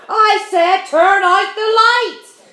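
bellowed, slow and very loud; furious shout. A narrator's reading. Centipede yelling at the glowworm to kill the light. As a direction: roar the order across the room. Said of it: "shouted the centipede".

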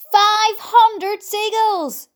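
very slow, high, drawn-out shout. A narrator's reading. James announcing his huge count, shouting. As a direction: bellow the tally, stretching every word. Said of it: "James shouted".

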